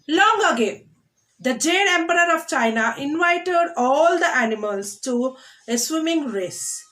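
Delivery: put-on lively read-aloud to children; storytelling read. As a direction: open the tale like a storyteller. Reads story text to class.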